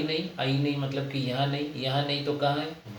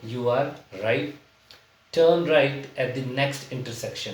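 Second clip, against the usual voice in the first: slow example reading; much slower than usual. Shown as a demonstration. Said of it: "teacher reads example sentences".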